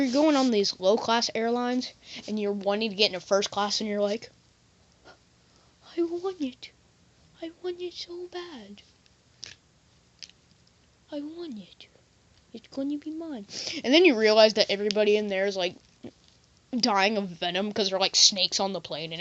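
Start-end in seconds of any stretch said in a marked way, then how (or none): 6.0-13.5 high, hushed creepy craving; covetous longing. Acted. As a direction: whimper it softly with obsessive want. Acted craving for first class.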